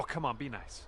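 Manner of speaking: usual speaking voice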